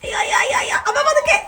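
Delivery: high-pitched, urgent cries of dismay; real alarm. Real, not acted. Mother cautioned while pulling a kitten from a pipe, high alarm. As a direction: plead in a high voice to go gently.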